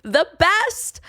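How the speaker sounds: high, bright sarcastic yeah; mock chirpy yes. Telling people about her job, mock chirpy. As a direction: chirp it like a proud new hire.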